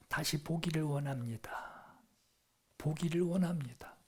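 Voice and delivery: near-whispered, humble request; hushed plea. Retold or imitated. Blind man answers Jesus, hushed plea. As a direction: plead very softly, almost under the breath.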